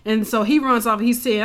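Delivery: normal talking voice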